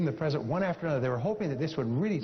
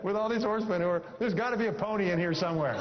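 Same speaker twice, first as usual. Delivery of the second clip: higher, laughing through the punchline; a delighted boy sure of his treasure; gleeful kid; 5 semitones higher than usual. Acted. Johnny answering his parents from the pile, higher, laughing glee.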